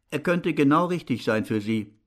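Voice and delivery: plain reading voice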